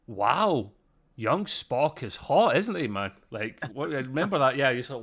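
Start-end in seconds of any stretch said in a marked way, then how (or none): none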